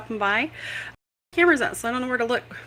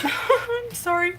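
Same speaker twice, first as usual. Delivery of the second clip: laughing; 12 semitones higher, much slower than usual. Real, not acted. Laughing at own full mouth.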